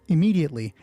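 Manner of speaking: ordinary talk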